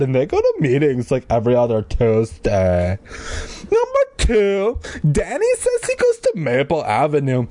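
put-on high chatty teen girl reading her letter; bubbly schoolgirl letter voice. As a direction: gossip like an excited eighth grader. Hannah's letter read in girl voice.

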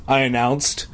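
usual speaking voice

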